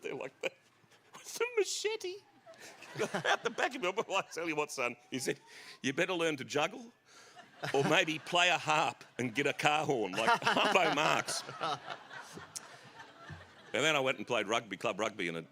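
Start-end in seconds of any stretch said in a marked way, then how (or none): 4.1-11.4 mocking dad. Father mocks son's perm.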